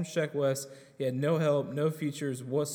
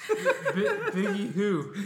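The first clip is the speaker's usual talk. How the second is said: laughing; 7 semitones higher, 4 dB louder, much slower than usual. Real, not acted. Mocking dismissal of rappers.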